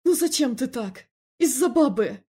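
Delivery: loud, high reproach; exasperated regret. A narrator's reading. Felix scolding his brother over a woman, exasperated. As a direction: reproach him loudly, exasperated. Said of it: "с искренним сожалением".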